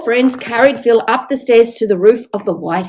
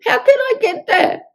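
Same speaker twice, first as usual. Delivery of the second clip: pleading sick man; 13 semitones higher than usual. Acted. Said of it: "asked Phil".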